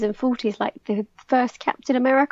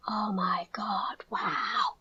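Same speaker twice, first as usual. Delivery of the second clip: slow, hushed whisper of amazement; awestruck whisper; 2 semitones lower, 10 dB quieter, much slower than usual. Retold or imitated. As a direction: imitate a gasping, amazed crowd. Imitating an awestruck audience.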